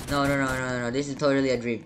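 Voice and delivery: ordinary talk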